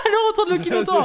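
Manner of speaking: high laughing cheer; excited shout. Real, not acted. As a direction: hype it up, laughing.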